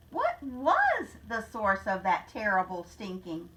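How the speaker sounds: ordinary delivery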